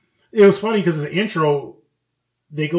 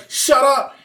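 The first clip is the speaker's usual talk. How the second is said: loud, high mock snap; scolding bully; 11 semitones higher, 5 dB louder than usual. Bully telling his mom off, mock-angry. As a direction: cut her off with a raised voice. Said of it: "he scalded his mom".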